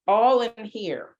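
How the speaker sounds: usual speaking voice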